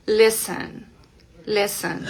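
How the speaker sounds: "listen" said as "lissen"; silent T. Shown as a demonstration. Teacher showing a common verb, silent T. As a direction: leave the T out of "listen" entirely. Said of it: "no suena, cero T".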